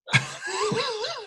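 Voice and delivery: high burst of laughter. Real, not acted. Storyteller laughing at his own punchline.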